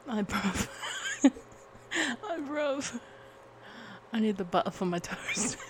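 put-on playful British street accent, lightly done; mock British accent, on the edge of laughing. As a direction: attempt a British "bruv" accent.

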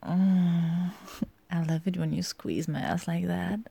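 ordinary talk

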